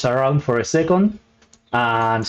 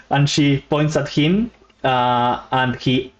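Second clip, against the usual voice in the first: normal talking voice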